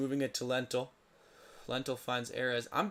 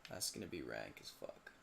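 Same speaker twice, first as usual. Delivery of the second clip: low mutter; 11 semitones lower, 12 dB quieter than usual. Real, not acted.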